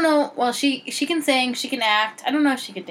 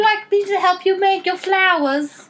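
sweet offer; 8 semitones higher, 4 dB louder than usual. Pretend. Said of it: "they're like".

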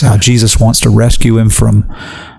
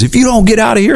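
high-pitched furious warning; mock threatening; 11 semitones higher, faster than usual. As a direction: imitate an angry man running someone off. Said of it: "he's trying to threaten him".

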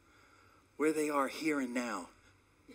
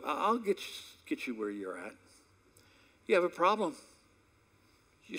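normal talking voice

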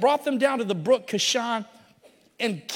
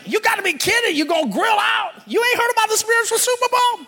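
much higher, loud disbelieving yell; shocked shout; 11 semitones higher, 3 dB louder, faster than usual. Acted. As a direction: shout it like he can't believe his friend missed it. Excited friend tells the news.

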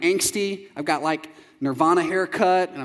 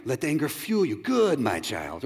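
Emperor impression; 4 semitones lower, 4 dB quieter than usual. Preacher voicing Star Wars villain coaxing anger, sinister. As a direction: creep it out like an evil emperor. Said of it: "suppress that anger".

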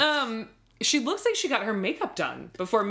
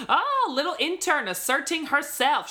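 higher-pitched, affectionate teasing imitation; mock-teasing; 7 semitones higher than usual. Podcaster imitating a fashion editor needling his intern, playfully mocking. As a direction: imitate a grand man half complimenting, half mocking. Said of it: "he's kind of mocking her also".

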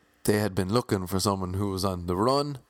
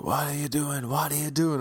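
retold shouting; 5 semitones higher than usual. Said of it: "And they're all shouting".

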